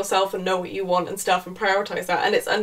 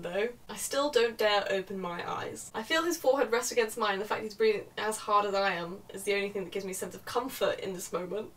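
soft, quiet story reading; softly voiced first-person narration; novel read aloud; 9 dB quieter than usual. A narrator's reading. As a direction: read the passage out in a hushed-down voice.

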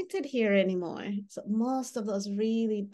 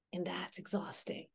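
breathy whisper on "exhausting"; whispered aside; 11 dB quieter than usual. Real, not acted. Trainer to audience about constant alarm, whispered. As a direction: drop to a whisper.

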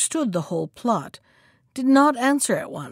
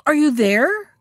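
raised, louder questioning call; crying out; 4 semitones higher, 5 dB louder than usual. A narrator's reading. Tired shark to the hidden monkey, calling out. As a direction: call up, pitch climbing at the end. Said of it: "cried the shark".

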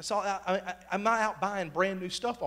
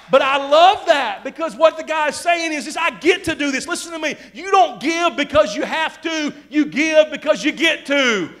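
high, loud preacher's shout; full-force preaching; 8 semitones higher, 8 dB louder than usual. Real, not acted. Preacher urging the congregation to give gladly, full-force preaching. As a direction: drive each line home, pitched up and loud.

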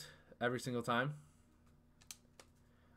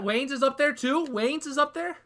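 high, rising disbelief; surprised; 12 semitones higher, 7 dB louder than usual. Real, not acted. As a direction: ask it in sudden shock. Surprised at play.

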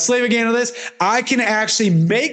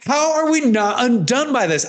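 higher, marvelling; raised pitch, wonderstruck; quoting himself amazed; 6 semitones higher than usual. Retold or imitated. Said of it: "I'm like".

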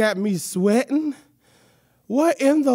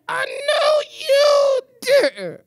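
high, drawn-out indignant cry with a laugh; a puppet mock-outraged at a hair insult; offended squeal; 14 semitones higher, 4 dB louder, much slower than usual. Puppet insulted about her extension, squealing high and outraged.

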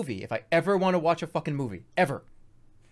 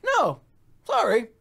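high, louder false politeness; mock polite brush-off; 12 semitones higher, 4 dB louder than usual. Refusing the seatmate's trick, falsely polite. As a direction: chirp a curt dismissal, pitched way up.

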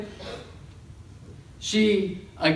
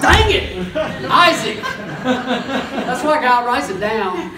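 loud, much higher laughing outburst; laughing at own slip; 6 semitones higher, 6 dB louder, slower than usual. Real, not acted. Preacher laughing at his own name mix-up, loud and high. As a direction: crack up at the mix-up. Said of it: "I don't know what I'm saying".

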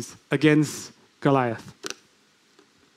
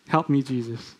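soft, humble prayer; quiet plea; 3 semitones lower, 6 dB quieter than usual. Shown as a demonstration. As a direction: say it softly as a plea.

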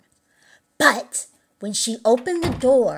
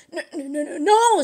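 frightened stammer; 5 semitones higher than usual. A narrator's reading. Little Jack Horner accused of stealing, frightened stammer. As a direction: stammer up to a high no.

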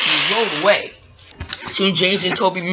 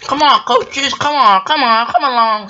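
high, louder urging; calling coaches along; 6 semitones higher, 4 dB louder than usual. Acted. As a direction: chivvy them along with raised voice. James calls his coaches along.

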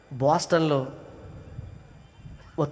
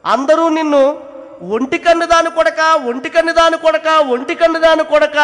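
high, loud grief-stricken cry; mother grieving over the taunts her son suffered; anguished outcry; 12 semitones higher, 11 dB louder than usual. Acted. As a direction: wail the old taunt in pain.

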